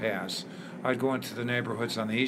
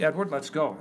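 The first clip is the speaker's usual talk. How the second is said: mother's yell; 6 semitones higher than usual. Retold or imitated. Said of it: "My mother yelled at me".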